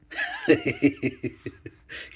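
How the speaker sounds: real tittering laugh, starting very high; high-pitched giggle. Real, not acted. Vlogger amused by child in kilt, high giggle. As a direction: giggle away at your own silliness. Giggling after squealed catchphrase.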